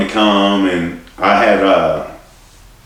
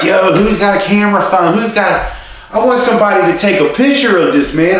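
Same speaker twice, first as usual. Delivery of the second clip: high, loud, urgent call for a camera; excited yelling; 13 semitones higher, 4 dB louder than usual. Retold or imitated. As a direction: shout from the stretcher, hyped and hurting. Retold yelling from ambulance, gunshot.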